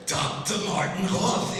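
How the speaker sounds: high, slow mock-German announcement; heavy German accent. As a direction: imitate a caller declaring his title with a German accent. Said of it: "in a very heavy German accent".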